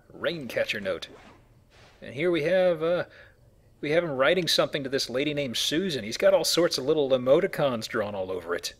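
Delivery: ordinary delivery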